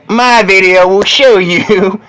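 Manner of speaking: high, loud hype yell; excited shout. Real, not acted. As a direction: shout the punchline with glee.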